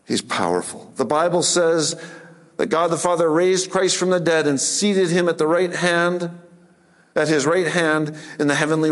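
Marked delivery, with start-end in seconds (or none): none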